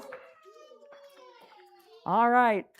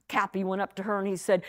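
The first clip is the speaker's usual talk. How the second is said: plain reading voice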